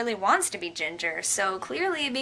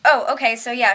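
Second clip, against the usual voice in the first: ordinary talk, nothing marked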